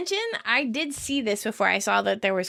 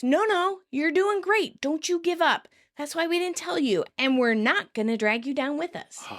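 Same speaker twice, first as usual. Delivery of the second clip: mock rebuke; 7 semitones higher than usual. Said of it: "Kim rebukes".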